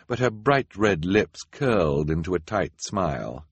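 ordinary talk, nothing marked

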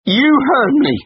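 very high, loud flat defiance; shouting. Acted. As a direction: shout it back at him. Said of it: "she shouted".

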